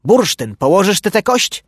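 loud, high scolding outburst; shouting. A narrator's reading. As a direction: shout a warning at the other dog. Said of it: "jak nie krzyknie".

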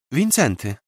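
Wincenty stated simply; small boy. Acted. Boy gives his name. (chłopiec spokojnie podaje imię Wincenty)

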